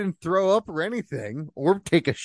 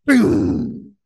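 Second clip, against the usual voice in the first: loud playful boom; boom effect; 8 semitones higher, 6 dB louder than usual. Retold or imitated. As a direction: blurt out an explosion. Sound effect word.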